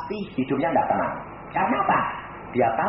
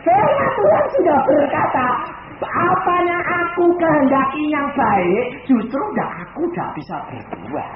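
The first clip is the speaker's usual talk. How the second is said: loud preaching with a crying edge; tearful preaching; 9 semitones higher, 6 dB louder than usual. Acted. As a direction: preach as if near tears. (berkhotbah sambil menangis)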